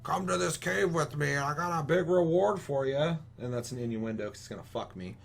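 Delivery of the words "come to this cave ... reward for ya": put-on higher, slower creepy old quest-giver voice; creepy old man. Gamer mocks an old quest-giver in a slow higher creepy voice. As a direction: lure him to the cave like a shady old man. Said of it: "weird old dude with gray hair who's like".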